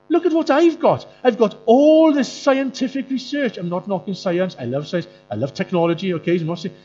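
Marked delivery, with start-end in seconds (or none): 0.1-3.6 much higher, louder mock show-off; high mocking voice of a proud culture; smug boasting. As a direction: brag like a know-it-all parading trophies. Voicing proud modern culture.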